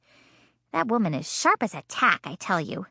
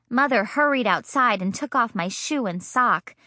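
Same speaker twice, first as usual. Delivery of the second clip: ordinary delivery, nothing marked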